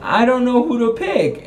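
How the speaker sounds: high, torn whine; conflicted. Acted. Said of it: "she's conflicted because she's like".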